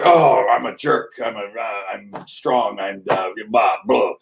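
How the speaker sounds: slow, hammed-up bully; strong jerk. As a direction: play a blustering jerk. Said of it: "So strong".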